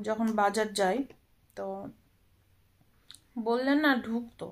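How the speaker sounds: ordinary talk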